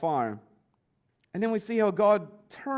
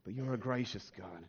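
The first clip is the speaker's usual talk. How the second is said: prayer; 3 semitones lower than usual. Acted. Pastor praying for his church, hushed and reverent.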